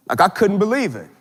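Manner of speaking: normal talking voice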